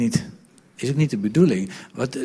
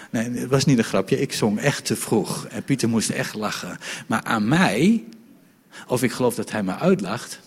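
ordinary talk

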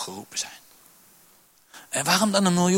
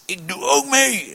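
higher, slow, mock-tough declaration; tough pose; 8 semitones higher, 4 dB louder, slower than usual. Preacher mocks a churchgoer standing tall to give, slow and mock-tough. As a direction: puff up and announce it like a hero. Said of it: "Heel stoer ga je recht staan".

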